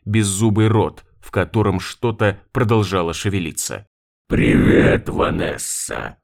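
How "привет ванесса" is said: slow, drawn-out gurgling whisper; drowned ghost. A narrator's reading. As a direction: stretch every sound, creepy and wet. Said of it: "растягивая буквы жутким, булькающим голосом". (жуткий булькающий голос)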